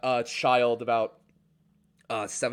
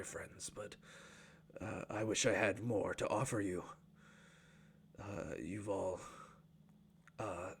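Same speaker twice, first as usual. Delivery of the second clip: low, soft, humble apology; old dwarf apologising, low and soft; apologetic old dwarf; 3 semitones lower, 4 dB quieter than usual. Acted. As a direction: lower and quieter, sorry to guests. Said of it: "turns back apologetically".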